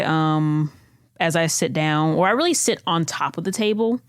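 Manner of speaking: ordinary talk, nothing marked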